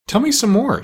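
excited. A narrator's reading. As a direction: ask eagerly, voice jumping up. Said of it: "all excited".

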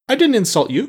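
high indignant protest. A narrator's reading. Jack protests to the Scarecrow, indignant and high. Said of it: "protested Jack indignantly".

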